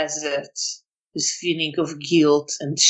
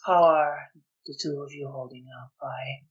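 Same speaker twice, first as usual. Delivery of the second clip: soft, halting character voice; guilt-ridden man, resigned; 3 semitones lower, 9 dB quieter than usual. Acted. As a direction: let it out quietly, with long pauses.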